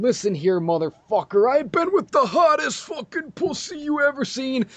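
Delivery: mock tough-guy voice, jumping high on the last line; swaggering alpha male snarl; alpha male bravado. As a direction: puff up like a macho alpha. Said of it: "my own special brand of alpha male shit".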